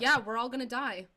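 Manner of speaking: loud, shrugging deadpan doom; mock-nihilist agreement, loud and amused; nihilist meme. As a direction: toss off the end of the world like a meme. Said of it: "started to sound like a nihilist meme".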